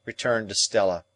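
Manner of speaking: ordinary delivery, nothing marked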